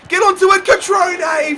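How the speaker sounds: high loud urging call; excited high call; urgent shout. Real, not acted. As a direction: shout at the player to get there. Urging player in attack.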